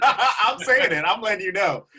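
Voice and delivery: high, loud laugh right through it, slowing down. Real, not acted. Owning the risky joke to his co-host, laughing. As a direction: laugh it out, then own it.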